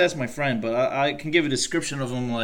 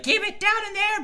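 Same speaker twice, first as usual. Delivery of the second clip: parent scolding. Retold or imitated. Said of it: "they'll be like".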